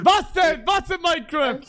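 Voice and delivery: loud demanding. Real, not acted. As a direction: call it out sharply. Host demanding answer loudly.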